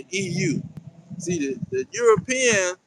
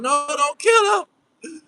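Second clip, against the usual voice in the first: slow, crying plea, pitched higher; mock tearful plea; 7 semitones higher, 3 dB louder, much slower than usual. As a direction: beg the police to spare him, half-wailing. Mocking a captive pleading for captor.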